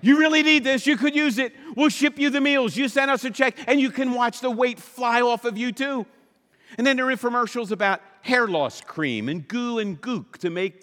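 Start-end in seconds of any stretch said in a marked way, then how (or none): 0.0-6.1 high, loud hammed-up ad voice; mock infomercial pitch. Mocking a diet ad to the congregation, high hammed-up pitch. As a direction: sell it like a cheesy TV ad, higher and louder. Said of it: "another infomercial".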